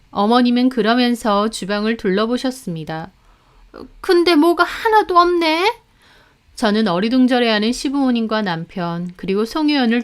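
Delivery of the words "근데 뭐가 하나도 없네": higher puzzled voice; mother-in-law surveying the kitchen. A narrator's reading. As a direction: look round and wonder. Mother-in-law looks round kitchen.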